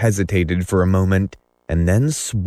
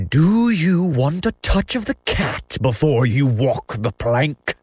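high-pitched pirate threat, put-on voice; raised, theatrical villain's cry; taunting cry; 7 semitones higher than usual. A narrator's reading. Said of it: "he cried".

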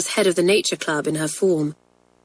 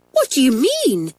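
higher-pitched aghast question; shocked; 6 semitones higher, 3 dB louder than usual. Acted. Girl hears friend's confession, shocked. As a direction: ask it aghast, voice jumping up. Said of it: "asked Pat, shocked".

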